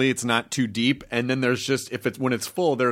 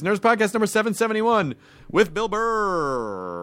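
high, big show-intro announcement; hammed-up announcer; 9 semitones higher than usual. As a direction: announce it grandly, stretching the last word out long. Hosts announce episode with big drawn-out sign-off.

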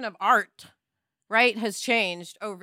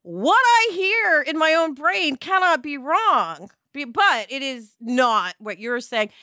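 slow and pressed, louder; emphatic self-quote; 3 semitones higher, 5 dB louder, slower than usual. Retold or imitated. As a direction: spell it out stubbornly. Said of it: "I'm like".

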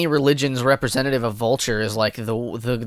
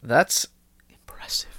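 whisper; 2 semitones lower, 5 dB quieter than usual. Pretend. Mock awe whisper.

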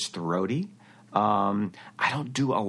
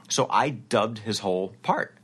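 usual speaking voice

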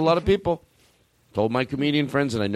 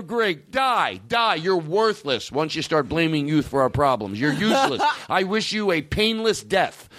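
higher, hammed-up furious rant; mock angry rant; 7 semitones higher than usual. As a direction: curse the old grumblers, half laughing. Ranting at people who blame youth.

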